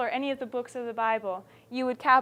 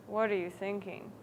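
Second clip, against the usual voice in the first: low, soft mumble; mumbled; 4 semitones lower, 9 dB quieter than usual. Shown as a demonstration. Teacher demonstrates Sam's line to students, mumbling. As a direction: mumble it quietly, pitched down. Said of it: "you know, mumbled Sam".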